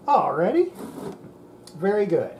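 usual speaking voice

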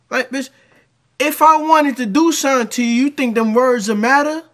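loud, high furious rant; angry rant. Acted. Man ranting back at the woman in the video, loud and high. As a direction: rip into her at full volume.

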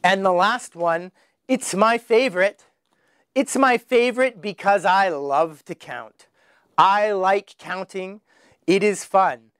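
normal talking voice

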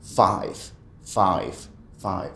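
five repeated, fading; drilling five. Shown as a demonstration. Teacher drills five for students. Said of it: "Dilo las, hasta que te fastidies".

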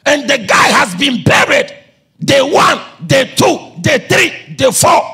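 high, shouted count of the days; emphatic preaching. Real, not acted. Preacher counting days since the burial, emphatic preaching. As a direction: punch out each day to the crowd.

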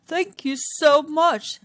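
a lion sincerely thankful; grateful Lion. Acted.

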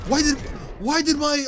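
high, stunned protest; baffled outburst. Real, not acted. Player to himself when the combo goes wrong, baffled. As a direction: blurt it out in sharp disbelief.

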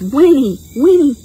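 high, loud whinnies; whinny like a pony. Shown as a demonstration. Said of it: "And there's a pony".

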